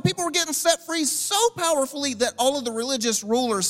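high, loud, incredulous rant; high-pitched, hammering reproach; exasperated Jesus. Acted. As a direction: scold forgetful followers at full pitch. Acted exasperated Jesus scolding his disciples.

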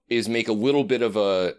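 usual speaking voice

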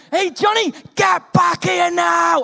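high, loud, slow yell; teacher yelling at a runaway boy; shouting after a kid. Retold or imitated. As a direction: bellow it across the pitch. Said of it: "I'm like that".

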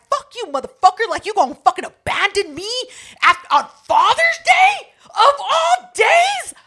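high, loud shouting, slowing at the end; retold Father's Day shouting rant; furious shout. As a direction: yell it with full drunken fury.

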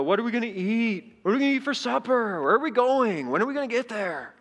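high-pitched pestering complaint, question after question; complaining. Acted. Israelites pestering Moses on the journey, complaining. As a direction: whine it like a fed-up crowd on a trek. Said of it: "imagine the complaining and the fighting".

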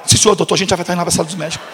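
fast submissive reply; hurried yes sir. Retold or imitated. Students rush to obey the "doctor", fast and meek. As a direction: rattle it off like an eager student.

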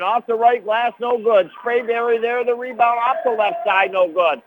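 announcer fired up before halftime; excited call. Real, not acted. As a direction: race the clock with the action. Frantic end-of-half possession.

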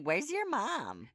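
high, slow drawn-out impression; Sheila. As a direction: mimic her asking, stretched and high-pitched. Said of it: "the way she's like".

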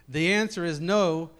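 ordinary delivery

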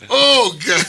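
high, loud mock horror. Friends recalling babies falling, loud high mock horror. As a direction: gasp it out in horror. Reacting to baby hitting floor.

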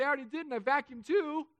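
put-on high, loud, proud child's reply; eager kids. Kids answering a parent about chores, eager and high. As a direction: play an over-eager helpful child.

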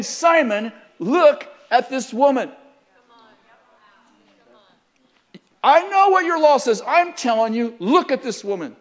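loud, high, forceful preacher's rebuke; insistent demand. Acted. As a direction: press each phrase hard and loud. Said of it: "He's saying".

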